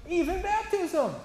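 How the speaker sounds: a hammed-up apostle exclaiming; mock Peter. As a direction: stretch it out in amazement as Peter. Voicing Peter, drawn out.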